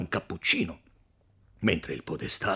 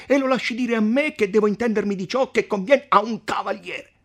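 higher, indignant arguing; hot-headed count; 11 dB louder than usual. Acted. Count defending the beating to podestà, indignant. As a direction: argue high and scornful.

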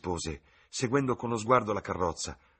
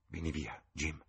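softer, urgent murmur; hushed "vieni via" with "vieni" leaned on; 3 semitones lower, 6 dB quieter than usual. A narrator's reading. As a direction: pull him away under her breath. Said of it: "mormorò".

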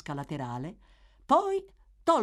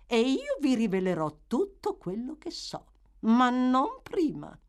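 calm woman setting her terms; calm matron; 9 semitones higher, 4 dB louder than usual. A narrator's reading. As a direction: hold your ground calmly. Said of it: "con la massima calma".

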